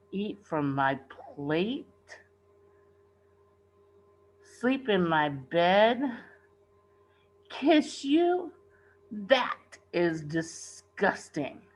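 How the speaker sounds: slow, drawn-out disgust. A narrator's reading. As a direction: recoil at each demand. Repulsed by the frog's demands.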